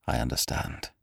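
breathed out grimly, almost voiceless; a low grim whisper, barely voiced. Cairn hearing of Ferrara's proposal, grim whisper. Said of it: "said Cairn grimly".

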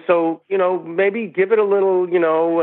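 a playful impression of the boss giving a note, pitched well above his own voice; imitating Jerry. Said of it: "And he goes".

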